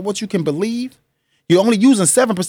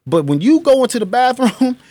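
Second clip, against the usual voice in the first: ordinary delivery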